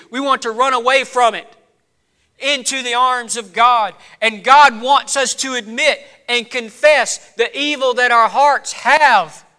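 emphatic sermon delivery; impassioned preaching. Real, not acted. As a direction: preach it forcefully, building to emphatic peaks. Preaches at full force.

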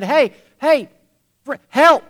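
loud, high yell from down a hole; stuck man hollering up to an unseen friend; calling out for help. Acted. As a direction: shout up to whoever passes. Trapped man calls up.

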